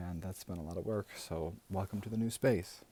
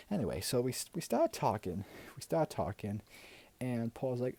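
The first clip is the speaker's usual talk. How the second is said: gushing auntie voice; 2 semitones higher than usual. Acted. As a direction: play a doting, gossipy aunt. Plays a gushing auntie character.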